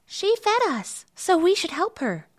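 very high, piping little bird; small bird voice. A narrator's reading. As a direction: chirp it up high as a tiny bird. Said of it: "heard these small voices".

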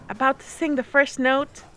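plain reading voice

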